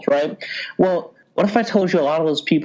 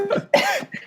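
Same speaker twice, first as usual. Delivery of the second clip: short high-pitched wheezing giggle; high squeaky giggle. Real, not acted. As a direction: snort out a high laugh at your own joke. Laughing at sponsor joke.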